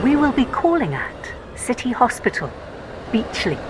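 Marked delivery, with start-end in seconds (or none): none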